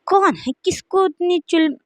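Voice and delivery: sharp high demand, pitched well up; angry outburst. A narrator's reading. Jungkook woken from sleep, angry high outburst. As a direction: snap at whoever woke him. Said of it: "गुस्सा करते हुए बोलते है".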